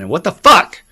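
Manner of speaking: loud and high, a furious yell at God; a sudden loud cursing cry at God; angry outburst. Retold or imitated. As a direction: let the rage burst out. Rant at God.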